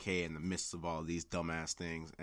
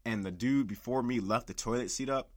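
usual speaking voice

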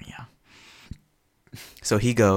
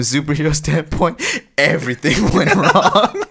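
high, loud words through laughter; laughing; 10 semitones higher, 9 dB louder than usual. Real, not acted. Speaker admits the heroes lost out, laughing loudly. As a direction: crack up loudly while speaking.

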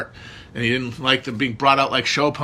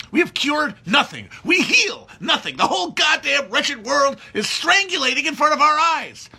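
furious shout; 13 semitones higher than usual. Acted. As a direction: scream it at the ceiling in despair. Monologue climax, raging shout.

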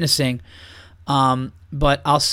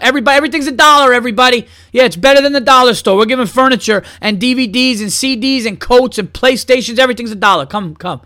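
higher, louder hawker calling out, the first line fast; mock sale barker; 8 semitones higher, 5 dB louder than usual. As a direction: hawk it to the whole street.

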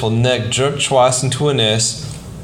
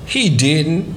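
contrarily; 5 semitones higher than usual. Acted. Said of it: "I said contrarily".

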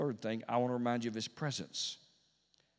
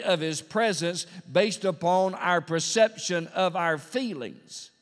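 loud, raised preacher's voice; full-force preaching; 8 semitones higher, 9 dB louder, slower than usual. Real, not acted. Preacher explains God's promise to the congregation, full-force preaching. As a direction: preach it high and hard, driving every phrase.

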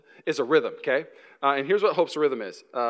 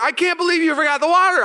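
much higher, louder whiny accusation; upset complaint; 12 semitones higher, 6 dB louder than usual. Retold or imitated. Husband accusing wife of forgetting water, high whiny complaint. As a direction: whine the accusation. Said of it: "started getting upset".

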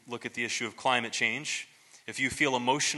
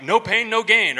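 brushing it off; 9 semitones higher, 10 dB louder than usual. Retold or imitated. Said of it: "I passed it off".

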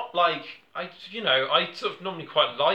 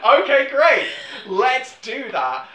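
much higher, louder, slow mock approval; mock eager executive; 8 semitones higher, 7 dB louder, slower than usual. Netflix executives cheerfully sign off, hammed-up. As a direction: chirp a slow, dopey yes.

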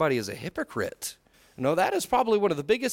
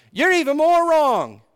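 high, loud, slow mock outburst; corrected angry take; 10 semitones higher, 8 dB louder than usual. Shown as a demonstration. Wife imagined accusing the king, angry mock shout. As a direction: hammer out the accusation at full voice. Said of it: "It didn't sound right".

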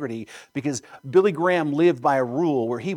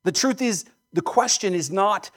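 ordinary talk, nothing marked

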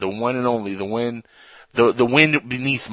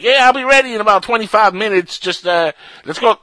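high, loud imitation of a southern caller; mock southern accent; 10 semitones higher, 8 dB louder than usual. Host mimics co-host's call before the show, mock southern accent. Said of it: "that kind of a southern accent".

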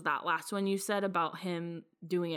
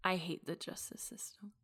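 whispering; 9 dB quieter than usual. Real, not acted.

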